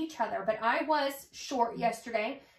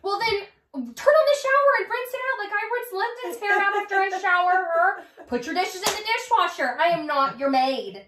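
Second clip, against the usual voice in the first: higher, louder fed-up rant; exasperated scolding; 6 semitones higher, 4 dB louder than usual. Retold or imitated. Venting about her sister's mess to her friend, exasperated scolding. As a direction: lay down the law to a messy housemate.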